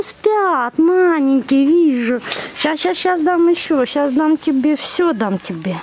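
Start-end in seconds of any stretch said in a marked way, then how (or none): none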